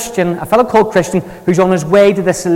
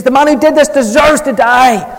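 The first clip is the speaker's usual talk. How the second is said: higher-pitched, forceful sentence of death; king pronouncing a death sentence, enraged; furious verdict; 8 semitones higher, 4 dB louder than usual. Retold or imitated. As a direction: condemn the man with hot anger. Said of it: "he pronounces a death sentence on him".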